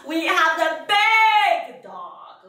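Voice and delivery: loud, slow, stretched-out big; big-dog voice. Shown as a demonstration. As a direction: announce something huge, slowly and loudly. Acting out dog sizes for children.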